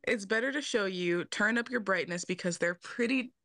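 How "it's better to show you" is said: ordinary talk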